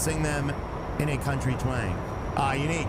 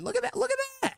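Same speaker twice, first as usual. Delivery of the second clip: excited squeak; much faster than usual. Real, not acted.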